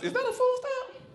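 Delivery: mock amazed finder; shocked discovery. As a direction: spot it and gasp. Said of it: "acted surprise at food stamp".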